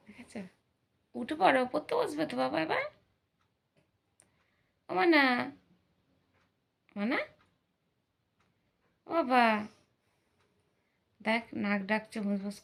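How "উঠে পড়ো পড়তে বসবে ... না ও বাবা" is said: loud, high call to wake her son. Real, not acted. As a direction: call him up from his sleep, louder each time. Mother calling sleeping son awake. (ঘুমন্ত ছেলেকে জোরে ডেকে তোলা)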